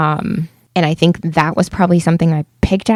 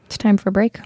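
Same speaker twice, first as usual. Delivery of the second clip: soft ASMR break announcement; 2 semitones higher, 4 dB quieter, faster than usual. Acted. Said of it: "Missus A S M R voice".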